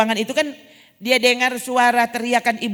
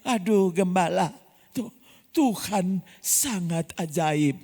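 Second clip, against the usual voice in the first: hushed wonder; 3 semitones lower, much slower than usual. Retold or imitated. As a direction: breathe it out in amazement. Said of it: "Jadi pas muncul pendeta".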